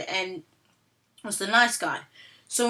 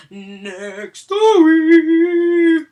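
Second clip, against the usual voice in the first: high, loud, very slow drawn-out announcement; hammed-up showman reveal; dramatic gasp; 8 semitones higher, 11 dB louder, much slower than usual. Real, not acted. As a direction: gasp and stretch it out grandly. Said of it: "gasp then drawn-out announcement".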